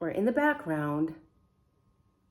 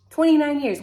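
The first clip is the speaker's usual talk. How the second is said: higher and louder disbelief; incredulous exclamation; 6 semitones higher, 8 dB louder, faster than usual. Real, not acted. Vlogger stunned by the chief's long service.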